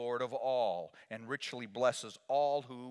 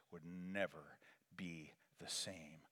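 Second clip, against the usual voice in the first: whisper; 6 semitones lower, 11 dB quieter, slower than usual. Real, not acted. Sermon ends on hush.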